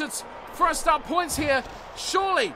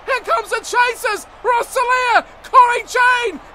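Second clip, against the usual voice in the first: much higher and louder, shouted race call; excited play-by-play; 5 semitones higher, 6 dB louder than usual. Acted. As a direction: ride the foot race at full volume. Live call of a long break.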